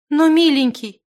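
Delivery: high, loud, imploring cry; tearful pleading. A narrator's reading. Zimana runs to the wizard, tearfully pleading. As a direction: beg him, close to tears. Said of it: "подбежала к нему со слезами Зимана".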